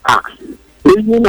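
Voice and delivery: usual speaking voice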